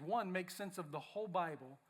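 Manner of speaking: ordinary talk, nothing marked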